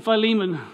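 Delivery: "Philemon" with long i, then stressed "ee"; speaker's own pronunciation. Shown as a demonstration. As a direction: say "Philemon" as fie-LEE-mun. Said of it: "so I'm saying".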